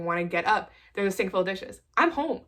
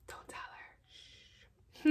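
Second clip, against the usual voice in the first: whisper; 12 dB quieter, much slower than usual. Real, not acted. Secret about sister's mask.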